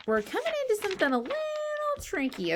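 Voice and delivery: playful rushed buildup; fast high teaser; rushed excited tease. Pretend. Building suspense for kids.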